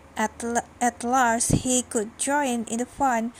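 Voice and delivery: usual speaking voice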